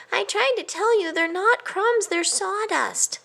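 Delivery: high, then slow on the last; apologetic mouse. A narrator's reading. A guilty mouse tells his cousin, high. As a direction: explain sorrowfully.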